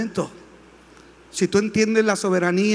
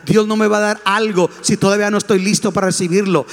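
usual speaking voice

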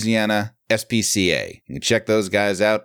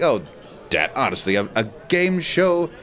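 Frasier-y; 5 semitones higher than usual. Retold or imitated. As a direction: imitate a snobbish son objecting to dad. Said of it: "they get all Frasier-y on".

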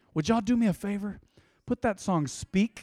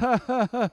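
forced higher laugh, doing your best; fake laugh; 6 semitones higher, 4 dB louder than usual. Shown as a demonstration. Preacher demonstrating laughing through struggle, fake laugh. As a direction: make yourself laugh, clearly put on. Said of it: "kinda gotta do your best".

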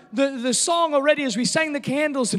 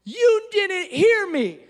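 high, slow, hurt little voice; a child complaining he was ignored; high whiny child's complaint; upset child; 7 semitones higher, slower than usual. Retold or imitated. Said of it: "He says".

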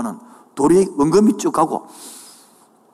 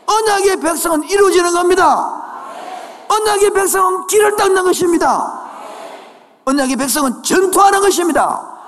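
shouted, high climactic sermon lines; full-force preaching; 12 semitones higher, 3 dB louder than usual. Acted.

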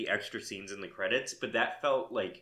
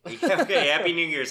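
higher, louder mock-festive cheer; mock cheery greeting; 6 semitones higher, 7 dB louder than usual. Host mimicking the group's New Year's greeting, mock cheery. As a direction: imitate a hurried party greeting, loud and high.

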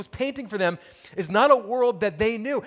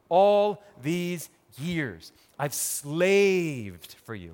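slow pleading; much slower than usual. Acted. Older brother complaining to his dad, slow and drawn out. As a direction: drag out all these years slowly.

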